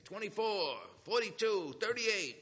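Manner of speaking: high, loud imitated inmates' calls; acted shouts from separate cells; calling out. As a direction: holler each number down the cell block. Inmates calling out cell numbers.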